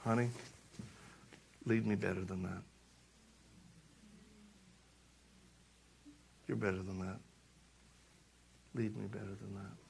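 low, soft, measured plea; hushed and low, letting each line land; wife's quiet appeal. Retold or imitated. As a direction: imitate a wife gently appealing, with long pauses. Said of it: "she goes".

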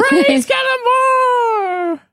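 very high, slow, laughing shout; laughing cheer. Acted. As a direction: echo it laughing and exultant. GM echoes the cheer.

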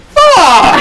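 high, loud laughing curse; mock outburst. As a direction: burst out a hammed-up swear. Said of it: "And she goes".

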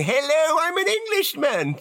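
put-on milk the accent for the shop staff; hammed-up Englishman. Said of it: "I would be milking it like you wouldn't believe".

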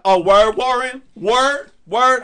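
high, loud, drawn-out challenge; Lacey. Retold or imitated. Mimicking a friend reacting to his confession, mock indignant. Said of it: "can't you hear Lacey's voice right now?".

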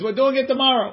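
high-pitched retold yell; mock scream. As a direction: pitch it way up, calling it out. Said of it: "and then he screams".

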